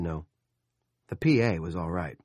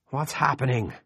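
panicked father; 6 semitones higher, 4 dB louder than usual. A narrator's reading. Frightened father.

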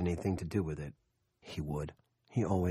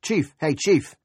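loud high call; 9 semitones higher, 8 dB louder, slower than usual. A narrator's reading. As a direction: call across the field. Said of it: "raised his voice".

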